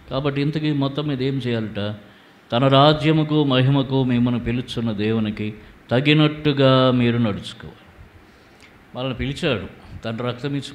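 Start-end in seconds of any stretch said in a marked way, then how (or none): 2.5-7.8 loud emphatic verse. Shown as a demonstration. Preacher repeating the key verse to the church, loud and emphatic. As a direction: declaim the verse like a call to the congregation. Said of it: "repeated verse, second take emphatic".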